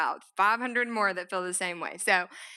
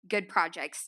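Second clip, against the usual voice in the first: ordinary talk, nothing marked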